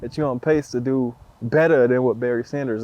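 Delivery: usual speaking voice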